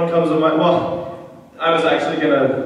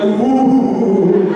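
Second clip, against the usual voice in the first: louder, higher drawn-out mock ooh; imitated crowd "ooh"; 4 semitones higher, 5 dB louder than usual. Speaker imitates the crowd reacting to word suggestions, mock oohing. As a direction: mimic the audience's oohing, loud and long. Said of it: "I just love the noises coming out of the crowd".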